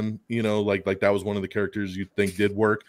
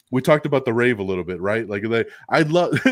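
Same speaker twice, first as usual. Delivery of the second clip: ordinary delivery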